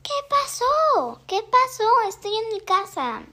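put-on a startled girl answering from next door; surprised Hannah. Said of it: "Hannah dijo".

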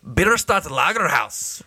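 hammed-up German accent on the brewery name. Shown as a demonstration. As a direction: put on your best mock German accent. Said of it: "That's the best German accent I have".

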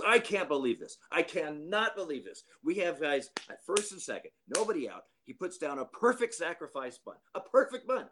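high, exasperated manager ranting; mock a fuming manager throwing his arms up; animated manager rant. Manager venting to the umpire, high and exasperated. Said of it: "Kelly's being very animated".

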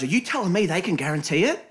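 retold indignant challenge; acted incredulity. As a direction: cut in with mock disbelief. Acts Sonny butting in at meeting.

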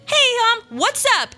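fake cheerful greeting. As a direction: pretend all is fine for mom. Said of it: "hide behind the mask".

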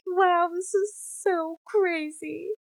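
high, drawn-out wonder; retold amazement. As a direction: marvel slowly, pitch way up. Retells own amazed reaction to reading.

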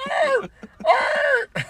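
high, loud barking yelps; loud yappy dog barks; dog bark imitation. Shown as a demonstration. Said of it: "I'm gonna sound like your dog bark".

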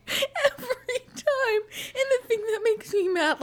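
high-pitched talk through laughter; laughing. Real, not acted. Complaining to co-host about husband, laughing. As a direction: complain while still cracking up.

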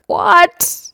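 high mock disbelief; shocked what. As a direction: squeak it out in shock. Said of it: "I'm like".

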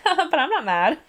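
high, loud mock "not mad"; sarcastic denial. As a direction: say it obviously mad, laughing. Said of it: "She's like".

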